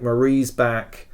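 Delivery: usual speaking voice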